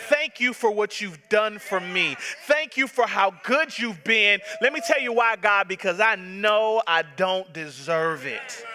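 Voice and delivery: ordinary talk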